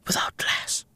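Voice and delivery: unvoiced, fast whisper; hushed, secretive repeat of the question. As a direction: drop to a conspiratorial whisper. Sudden whisper after shouting.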